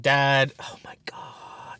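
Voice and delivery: imitating an embarrassed daughter in a whisper; whispered groan, slowing on the last words; exasperated kid. Daughter fed up with dad's stories, exasperated whisper. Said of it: "And she's like".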